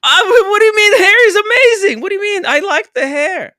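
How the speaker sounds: very high, loud whiny outburst; wailing protest. Real, not acted. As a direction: screech in offended protest. Protesting insult to the hair.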